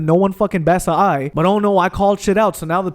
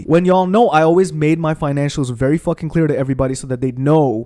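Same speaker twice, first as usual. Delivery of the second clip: usual speaking voice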